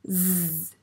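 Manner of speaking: Z as a long buzzing zzz; sustained zzz; isolated z sound. Shown as a demonstration. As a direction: hold the sound of Z, not the letter's name. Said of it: "comme dans Zorro".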